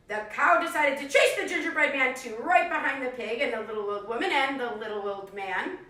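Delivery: put-on lively read-aloud for young kids; animated picture-book narration; storybook reading. A narrator's reading. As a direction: tell it like a classroom story time. Teacher reads story to class.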